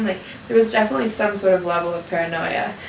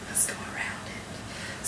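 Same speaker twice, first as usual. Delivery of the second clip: slow unvoiced whisper; 17 dB quieter, slower than usual. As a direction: hush it so nothing hears. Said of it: "He's like".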